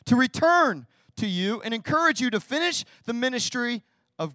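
slow, high and loud emphasis; fervent scripture reading. Acted. Preacher reading Paul's letter to the congregation fervently. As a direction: proclaim it like a letter read aloud.

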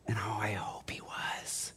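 breathy whispered hope; whispered aside. Real, not acted. Pastor hoping Paul mocked the cultists, whispered aside. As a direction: whisper it to yourself, relishing the thought.

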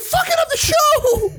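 very high, drawn-out screech; hammed-up high wail of outrage; mock-outraged yell. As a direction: shriek it slowly, stretching the last word. Mock outrage amid laughter.

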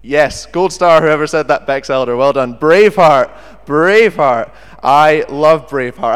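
loud eager cheering; excited. Real, not acted. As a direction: burst out with delight. Excited cheer about favourite film.

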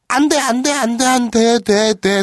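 high, loud repeated refusal; hammed-up frantic objection; acted protest. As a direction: cry out in comic protest, easing a little. Acted protest that turns to consent.

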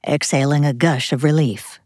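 ordinary delivery, nothing marked

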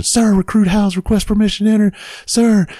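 high, strained monotone recruit cry; recruit's shouted request. Retold or imitated. Recruit requesting permission at the door, high monotone call. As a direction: chant the request on one high note. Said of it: "I keep requesting permission".

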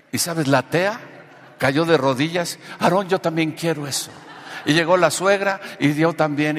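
impassioned preaching. Real, not acted. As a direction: build to a ringing revival proclamation. Preaches the testimony's climax.

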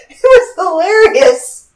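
high, slowed, crying with laughter; barely getting it out through laughter; laughing to tears. Real, not acted. Telling the listener the funny story, laughing to tears. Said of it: "I'm crying while I'm telling you".

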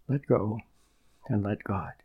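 soft repeat. Shown as a demonstration. Preacher repeats the saying softly for his listeners to absorb. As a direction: let it sink in gently. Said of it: "That's a beautiful expression".

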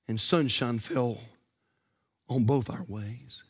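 low, measured verse reading; poem recitation. Real, not acted.

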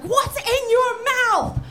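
slow, high yell with every word over-mouthed; imitated warden bellowing a question; exaggerated scream. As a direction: scream it slowly, over-enunciating for a deaf man. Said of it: "warden screams at him".

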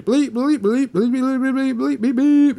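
high, rapid mock bleeps; censorship bleep imitation. Mimicking the cartoon's censor bleeps over the dog. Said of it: "the censorship bleep".

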